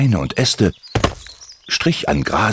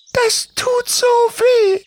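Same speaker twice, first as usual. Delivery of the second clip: sehr hohe, langsame, leidende Vogelstimme; seufzend; much slower than usual. Acted. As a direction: den Schmerz heraus seufzen. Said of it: "seufzte Doris". (Doris seufzt vor Timmy, hoch und langsam)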